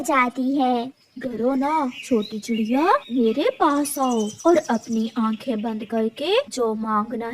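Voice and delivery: put-on kind old cactus tree. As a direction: soothe the frightened little bird. Kind magic cactus speaks.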